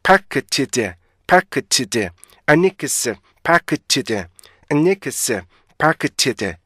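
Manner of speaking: "아니크스" joined up, then "파크치즈"; linked native syllables. Shown as a demonstration. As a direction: chant "아니크스, 파크치즈" in two even halves. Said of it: "이렇게 읽어주셔야 돼요". ("아니크스, 파크치즈"로 음절을 이어서)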